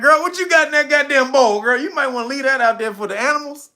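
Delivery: high, loud comic confrontation; hammed-up partygoer calling someone out; mock disbelief. Acted partygoers challenging the guest.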